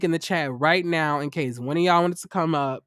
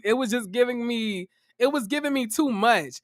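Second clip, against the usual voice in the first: ordinary delivery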